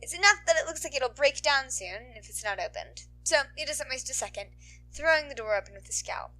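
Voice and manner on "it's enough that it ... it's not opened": ordinary delivery, nothing marked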